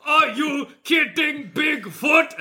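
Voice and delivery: slow, high monster shout; cartoon monster with Italian-tinged accent; angry Bigfoot voice. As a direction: protest like an outraged monster. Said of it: "when me and you do his voice".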